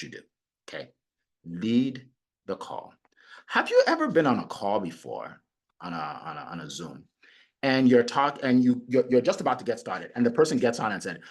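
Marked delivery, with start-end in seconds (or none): none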